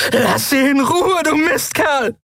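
high, desperate yell; hoarse-throated scream. A narrator's reading. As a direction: scream it through a sore throat. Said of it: "schrie sie mit Wunderkehle".

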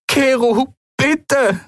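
a touch of tears in a high pleading voice; tearful plea. Acted. Endril begs Keru over a crackling cube, tearful. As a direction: call out to him through mild tears. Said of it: "Sie weinte".

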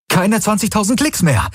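high, elated cheer; jubilant. A narrator's reading. Galen reports the sphere is close, jubilant and high. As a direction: cheer it out, pitch way up. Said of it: "jubelte Galen".

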